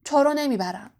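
understated angry refusal. Acted. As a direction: snap it at the boy, mildly. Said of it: "با عصبانیت گفت".

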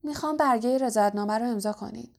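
fast, rushed request; hasty. Acted. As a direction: blurt it out quickly. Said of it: "هری شتاب زده گفت".

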